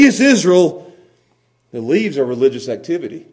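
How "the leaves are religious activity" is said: ordinary talk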